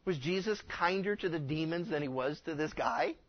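ordinary talk, nothing marked

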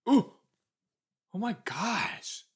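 genuinely floored by what just happened; shocked gasp. Real, not acted. Shocked by game character reveal.

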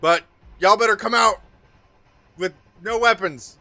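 loud, high shouted order; shouting from outside. Acted. Game master as voice outside ordering the party out, shouting. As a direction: call out to people inside, loud and high. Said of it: "a voice shout".

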